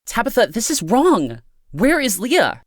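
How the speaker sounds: high, worried outcry; distressed exclamation. A narrator's reading. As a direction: cry out in high-pitched distress. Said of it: "Amanda exclaimed, her face twisted in distress and worry".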